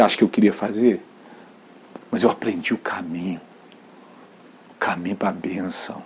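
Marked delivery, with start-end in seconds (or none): 2.1-6.0 whispered, softer, a little lower; hushed confession. Real, not acted. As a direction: let it slip out as a secret whisper. Pastor confides lesson to congregation.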